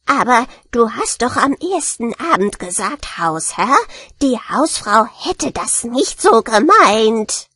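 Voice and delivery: slow, outraged rat voice; kreischende Ratte. Acted. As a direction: draw it out as a hurt, indignant rat. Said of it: "kreischte die Ratte". (empörte Ratte, gedehnt)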